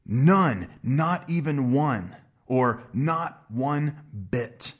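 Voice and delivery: "not one bit" spelled out slowly, each word weighted; emphatic, slow. Shown as a demonstration. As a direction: lean hard on "not even one". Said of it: "It's emphatic".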